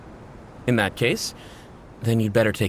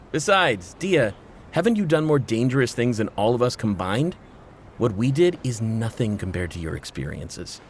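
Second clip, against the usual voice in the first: ordinary talk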